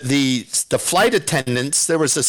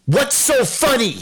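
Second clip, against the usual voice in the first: imitated angry demand, pitched high; pissed-off challenge; 7 semitones higher than usual. Stuart Scott confronting two laughing friends, imitated angry challenge. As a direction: mimic someone spoiling for a fight. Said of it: "Like he was gonna fight us. Like he was pissed".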